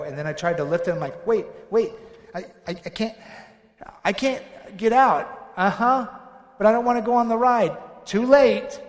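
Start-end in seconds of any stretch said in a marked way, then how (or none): 1.3-8.8 acted panic.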